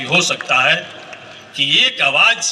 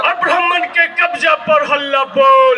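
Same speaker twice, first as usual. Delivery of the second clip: shouted slogan; 10 semitones higher than usual. Acted. Leader rouses the rally crowd, shouted slogan chant. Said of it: "इतना जोर से हल्ला बोलना होगा".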